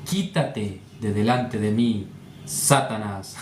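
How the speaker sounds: low-pitched, solemn command; grave tone. Shown as a demonstration. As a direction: say it deep and slow, with a stern seriousness. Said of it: "con tono grave".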